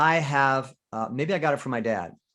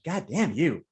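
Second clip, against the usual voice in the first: sweetly smiling curse, pitched higher, second one drawn out; smiling irritation; 4 semitones higher, 4 dB louder, much slower than usual. Shown as a demonstration. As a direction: curse through a smile, irritation underneath. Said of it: "You can be smiling and irritated".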